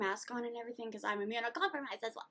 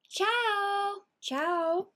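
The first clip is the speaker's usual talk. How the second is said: playful goodbye; 8 semitones higher, 5 dB louder than usual. Real, not acted. Sing-song goodbye.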